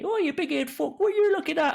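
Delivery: high, mock-angry street yell; mock shouting woman. Women shouting at him for looking, high mock yell. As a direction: play the rowdy woman yelling at a nosy watcher. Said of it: "they'll end up shouting at me".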